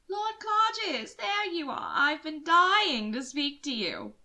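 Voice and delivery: drawn-out eager greeting; a fawning society woman; gushing lady. Acted.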